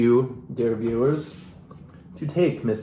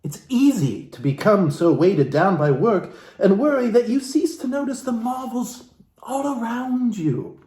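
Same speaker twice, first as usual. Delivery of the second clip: lightly theatrical book reading; a hint of stage reading from the book; dramatic reading; 5 semitones higher than usual. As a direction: read the passage like a soulful memoir. Reads Jane Seymour's book aloud.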